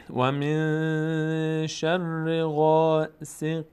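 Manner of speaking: و من شر غاسق drawn out; tajweed chant; slow recitation. Shown as a demonstration. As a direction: chant it reverently. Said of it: "recitation example".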